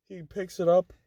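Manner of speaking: usual speaking voice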